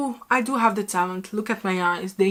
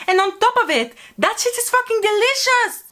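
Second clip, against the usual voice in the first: high-pitched, emphatic raving; gushing rant; 11 semitones higher than usual. Real, not acted. Raving about a favorite childhood dessert, gushing. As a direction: rave about it, voice shooting way up.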